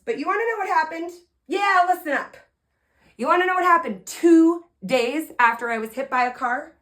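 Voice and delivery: high, loud, fast teasing hook; teasing the audience before the big reveal, high and loud; teasing build-up. Real, not acted. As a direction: hook the crowd with a teasing question.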